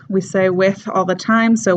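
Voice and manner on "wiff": "with" said as wiff. Shown as a demonstration. Teacher repeating a common word, "with" as wiff. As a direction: swap the TH of "with" for an F. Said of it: "comes out as an F".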